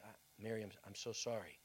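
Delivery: soft, low and sorrowful; softly consoling a grieving mother; quiet sorrow. Acted. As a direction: say it gently with sorrow. John consoles Mary.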